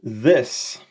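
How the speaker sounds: ordinary talk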